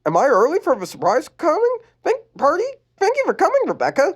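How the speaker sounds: put-on very high girly voice; corrected back to sweet girl; bright high teen girl; Lenny voice. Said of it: "wrong voice".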